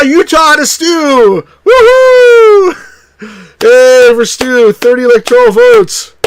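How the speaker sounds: high, loud, slow whooping celebration; joyful cheering. Real, not acted. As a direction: whoop it out like your guy just took the state. Stu wins Utah in game.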